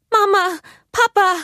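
high, loud calls for help; frightened girl calling her parents; desperate cry. Acted. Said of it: "rief Elsa verzweifelt".

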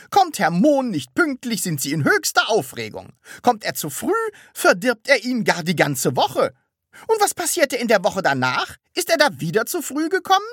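high, loud, nagging complaint; reproachful. A narrator's reading. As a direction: list the faults, then demand an answer. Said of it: "sagte sie vorwurfsvoll". (vorwurfsvoll nachbohrend)

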